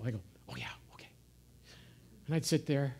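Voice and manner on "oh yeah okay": put-on breathy whisper of a sheepish child; whispered reply. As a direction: whisper it back, caught out. Boy answering teacher, whispered.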